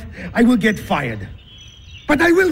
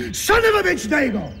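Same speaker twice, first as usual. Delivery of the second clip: imitating a driver yelling, higher; mock yell; 6 semitones higher, faster than usual. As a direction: toss the insult back in a mild mock yell. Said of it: "yell back".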